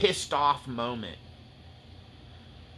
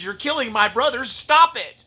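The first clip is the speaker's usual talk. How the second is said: building from firm to shouting, higher and louder; furious outburst; 11 semitones higher, 10 dB louder than usual. Retold or imitated. Preacher voicing Audie Murphy's fury, building to a shout. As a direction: rage over fallen brothers, rising to a yell. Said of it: "I just got angry".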